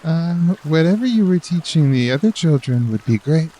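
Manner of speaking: hint of a child's voice; lightly voiced child. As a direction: play it like a keen pupil.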